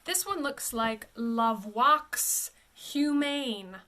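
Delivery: La Voix Humaine read slowly as it looks; misreading. Shown as a demonstration. Coach showing the tempting misreading. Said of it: "but it is La Foi".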